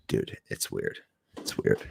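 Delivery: whispered, barely voiced "weird"; hushed. Real, not acted. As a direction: drop to a spooked whisper. Spooked hushed remark.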